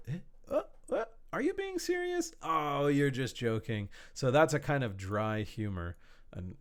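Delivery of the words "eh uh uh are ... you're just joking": high, startled double take; confused surprise. Acted. Listener who misses the dry joke, baffled. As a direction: squeak out the confusion, then catch on. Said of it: "the person has to".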